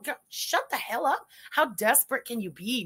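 ordinary talk, nothing marked

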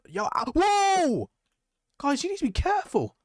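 very high startled outburst; high-pitched panicked warning; alarmed yelp. Real, not acted. Alarmed as others crash into him.

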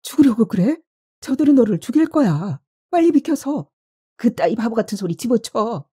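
high-pitched, alarmed scolding; heated, urgent rebuke; angry warning. A narrator's reading. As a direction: order them out of the way, voice pitched high. Acted quarrel amid the fox attack.

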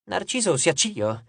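usual speaking voice

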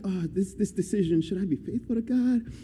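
soft, halting indecision; imitating someone who can't decide; wavering back and forth. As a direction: weigh it up as if torn. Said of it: "back and forth".

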